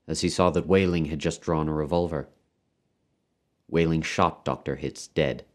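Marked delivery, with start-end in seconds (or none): none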